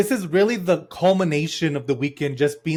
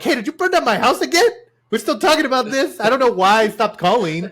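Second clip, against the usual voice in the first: high, raised-voice mock scolding; acted angry mom; 7 semitones higher, 5 dB louder than usual. Mom phoning her daughter about the burned house, acted scolding. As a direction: scold as an exasperated mom on the phone.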